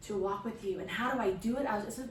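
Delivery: ordinary talk, nothing marked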